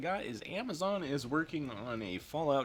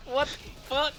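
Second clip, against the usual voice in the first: laughing disbelief; 14 semitones higher than usual. Real, not acted.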